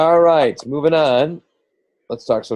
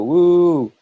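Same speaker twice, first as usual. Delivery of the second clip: little cheer; 6 semitones higher, 4 dB louder than usual. Pretend. Cheering for IU sweatshirt.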